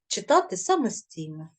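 usual speaking voice

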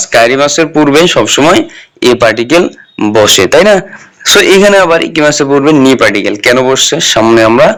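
ordinary delivery

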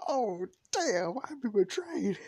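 high-pitched wail of betrayal; mock-dramatic dismay. Son given away by his mom, dramatic dismay.

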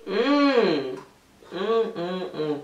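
chewing with closed lips; mouth full, chewing. Real, not acted. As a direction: hand covering the mouth. Seen 1: Hand at lips; closed, moving mouth chewing bitten chocolate.

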